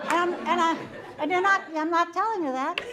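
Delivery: real laughter breaking through the words; giggling while admitting she won't tell; laughing. Real, not acted. Laughing at her own thoughts.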